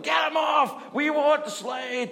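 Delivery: high, loud shout from the crowd; mock crowd shout. Fan in the crowd booing the support act, shouting. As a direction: yell it like a rowdy seventies fan. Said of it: "Everyone was like".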